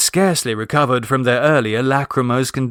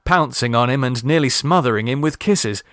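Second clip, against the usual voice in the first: ordinary delivery, nothing marked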